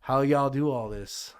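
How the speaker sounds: a light Texas twang put on for the son; son's casual Texan question, lightly imitated; imitating son's Texan. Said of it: "my son does constantly".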